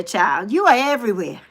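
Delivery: ordinary talk, nothing marked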